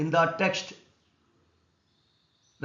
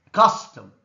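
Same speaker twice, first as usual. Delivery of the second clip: Custom with a loud, low CUS; stress on first syllable; 6 semitones lower, 10 dB louder than usual. Shown as a demonstration. Teacher reviewing exercise words, loud stress on CUS in Custom. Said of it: "C is stressed".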